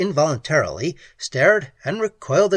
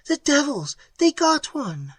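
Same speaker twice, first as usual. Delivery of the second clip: horrified; 13 semitones higher than usual. A narrator's reading. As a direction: cry out in shock at the killing. Pat horrified at the killing.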